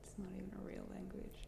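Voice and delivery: very soft, quick whisper; whispered aside. Real, not acted. Friend jokes about German during the lesson, whispered aside. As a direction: slip it in under the breath, fast. Muttered joking aside, unvoiced.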